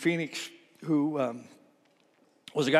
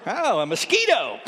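higher-pitched comic dismay at a bug; mock alarm; 5 semitones higher, 4 dB louder than usual. Preacher joking about his wife spotting him reincarnated, mock alarm. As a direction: play up the horror of spotting a mosquito.

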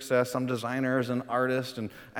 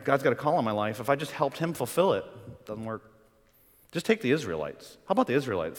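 ordinary talk, nothing marked

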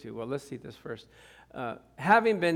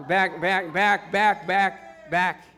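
loud mock shouting at a driver; playful angry driver yelling; mock road rage; 5 dB louder, much slower than usual. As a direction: wave the other car back, hammed up.

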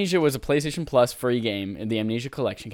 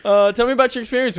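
mock interviewer; 9 semitones higher, 7 dB louder than usual. Teasing co-host mock interview.

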